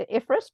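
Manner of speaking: "ifrs" pronounced as a single word; acronym as word. Retold or imitated. Quoting what many people say, "ifrs" as one word. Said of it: "Many people still say ifrs".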